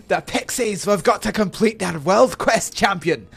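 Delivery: high, loud dwarf champion-summoner; Moradin. Acted. Dwarf urging his champion on a quest, high loud character voice. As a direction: call out urgent orders, pitched well up. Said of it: "Moradin, the Moradin one".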